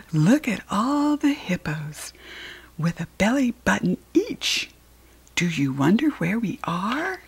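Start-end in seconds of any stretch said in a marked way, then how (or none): none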